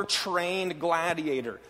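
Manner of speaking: ordinary delivery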